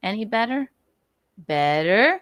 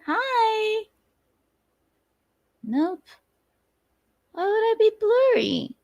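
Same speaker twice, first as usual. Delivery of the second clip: squeaky high voice; 13 semitones higher than usual. Pretend. High squeaky camera check.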